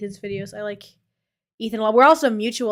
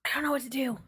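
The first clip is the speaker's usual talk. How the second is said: whispering; 2 semitones higher, 9 dB quieter, much faster than usual. Real, not acted. Unvoiced aside to chat.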